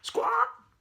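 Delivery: very high, loud squawk; parrot squawk. A narrator's reading. Parrot squawks at the baffled monkey. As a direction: screech like a parrot. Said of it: "the parrot said".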